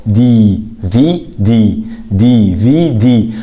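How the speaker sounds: دي في دي slow, every letter held long; drawn-out, separate letters in دي في دي; consonant onset shown. Shown as a demonstration. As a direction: spell out دي في دي slowly from its "d" sound. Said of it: "النطق هنا حرف إيه؟".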